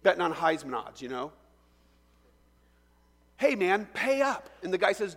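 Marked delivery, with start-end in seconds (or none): 3.4-4.5 demanding call. Acted. Creditor demands repayment.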